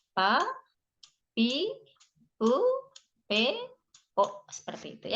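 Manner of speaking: "pa, pi, u, p, o" spelled out syllable by syllable, each sound separate; reading out pa pi pu pe po. Shown as a demonstration. Teacher to students, showing how the kana read, syllable by syllable. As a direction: say "pa", "pi", "u", "p", "o" as clear separate Japanese syllables. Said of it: "itu bacanya jadi".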